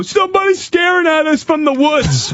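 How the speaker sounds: high shouted mock kid voice; alarmed kids. Kids warn the family of a watcher in the woods, high alarmed yell. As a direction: imitate kids yelling out in alarm. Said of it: "the kids go".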